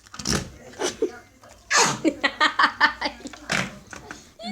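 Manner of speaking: loud breathy giggle; burst of laughter. Real, not acted. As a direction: crack up laughing. Laughs at slime fart noise.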